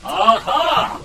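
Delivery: high, loud villainous triumph; triumphant aha. Acted. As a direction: crow it out in triumph.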